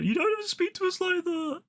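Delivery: squeaky-high put-on voice of offended cinemagoers; mock angry protest. Angry group answering back to him, mocking high imitation. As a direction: mimic their outrage in a silly high voice. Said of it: "they got so fucking angry".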